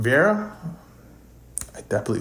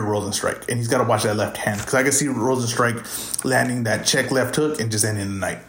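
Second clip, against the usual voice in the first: ordinary delivery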